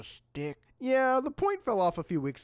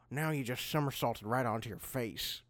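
normal talking voice

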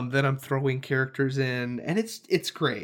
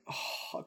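soft, voiceless gasp of amazement; imitated gasp; 13 dB quieter than usual. Dungeon master reliving the rescue, hushed gasp. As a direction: let out a hushed, awed breath. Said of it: "'cause it was like".

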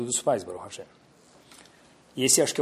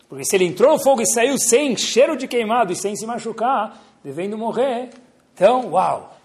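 excited; 7 semitones higher, 4 dB louder than usual. Acted. Excitedly retelling the miracle.

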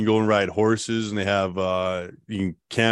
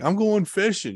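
cheerful insistence; 10 semitones higher than usual. Retold or imitated. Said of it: "I'm like".

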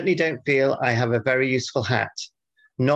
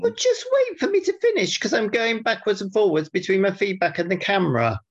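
defensive; 8 semitones higher than usual. Real, not acted. Defending tapping, pitch jumps.

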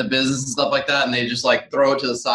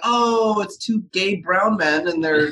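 high surprised realtor voice, drawn-out; imitating surprised agent; 5 semitones higher, slower than usual. Realtor reacting to the baby, mock surprised and high. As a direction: play the startled open-house agent. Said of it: "they're like".

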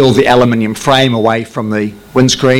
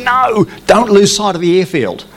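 higher, firm tower refusal; firm controller order; 9 semitones higher than usual. Retold or imitated. Controller refusing the pilot's request, higher firm voice. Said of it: "And they said".

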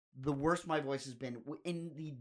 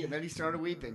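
ordinary talk

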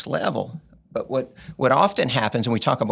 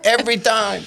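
put-on Cajun accent; 12 semitones higher, 4 dB louder than usual. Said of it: "he didn't say it with that accent".

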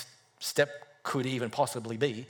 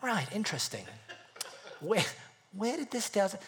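suspicious; 5 semitones higher, 7 dB quieter than usual. Retold or imitated. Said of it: "I'm like".

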